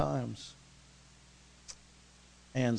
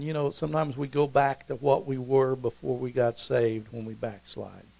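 normal talking voice

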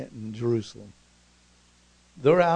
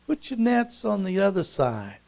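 high call across the water, gliding down; 7 semitones higher, 4 dB louder than usual. Acted. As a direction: shout instructions over to the boat. Stranger calls instructions from bank.

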